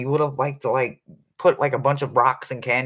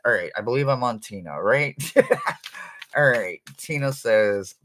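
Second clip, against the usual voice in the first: ordinary talk, nothing marked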